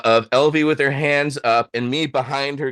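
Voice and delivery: ordinary talk, nothing marked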